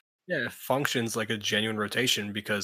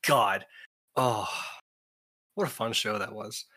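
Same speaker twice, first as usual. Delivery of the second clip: ordinary talk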